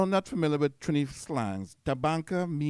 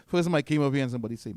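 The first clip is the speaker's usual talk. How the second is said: ordinary talk, nothing marked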